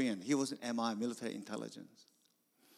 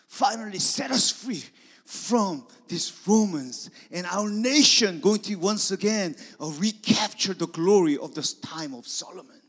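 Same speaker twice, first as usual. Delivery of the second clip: loud, high proclamation; rally the crowd with hope; loud triumphant cheer; 8 semitones higher, 12 dB louder than usual. Acted. Disciples dreaming of glory.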